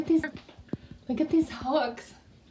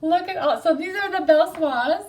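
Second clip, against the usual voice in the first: hyperventilating; 4 semitones higher, 6 dB louder than usual. Real, not acted. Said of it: "I'm hyperventilating".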